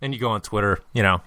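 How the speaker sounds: ordinary delivery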